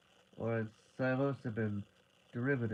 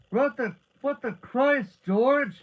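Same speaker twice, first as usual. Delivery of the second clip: high, loud alarmed yell; shocked, full-voiced shout; 12 semitones higher, 11 dB louder, slower than usual. A narrator's reading. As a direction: yell it out at the top of the voice. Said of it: "he yelled".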